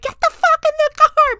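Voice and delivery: very high, loud mock-panicked command; frantic yelled order. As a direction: imitate a family screaming at mom to hurry. Voicing family yelling back.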